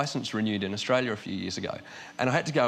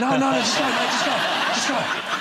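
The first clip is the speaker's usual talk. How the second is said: higher, louder panicky waving-through; flustered guard; 12 semitones higher, 6 dB louder than usual. Retold or imitated. Comic imitating an airport guard desperate to avoid offence, flustered. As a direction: hurry him on in embarrassed panic. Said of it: "he just went".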